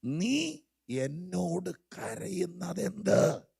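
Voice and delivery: slow, weighty voice of God, drawn out; God's question, slowed. Retold or imitated. Pastor quoting God to Moses, slow and weighty. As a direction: let each word hang as God asks Moses. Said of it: "അവിടെ പറഞ്ഞ വാക്കിതാണ്".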